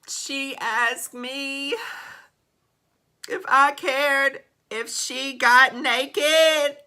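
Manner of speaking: high, slow, voice close to breaking; shaken disbelief. Real, not acted. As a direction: still rattled, dragging each word out. Shocked by naked request.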